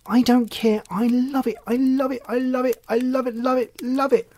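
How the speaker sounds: put-on high-pitched, insistent little-girl gushing; very excited child. A narrator's reading. Child insists she loves the story, very excited high voice. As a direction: repeat it up high, unable to contain yourself. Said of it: "said Rosie very excitedly".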